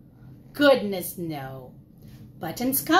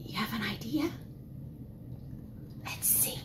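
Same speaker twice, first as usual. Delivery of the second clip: put-on hushed whisper to the kids; 7 semitones lower, 17 dB quieter, faster than usual. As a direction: whisper it like a secret. Teacher whispering to children.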